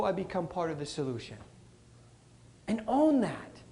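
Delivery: ordinary talk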